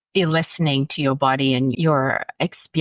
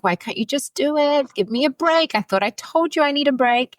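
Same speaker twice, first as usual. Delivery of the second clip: frustrated plea; 8 semitones higher than usual. Retold or imitated. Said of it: "Then I got frustrated".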